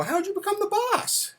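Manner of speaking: very high squeaky mock kid voice; amazed child. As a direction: imitate a little boy marvelling at his dad. Retelling child's amazed question to father.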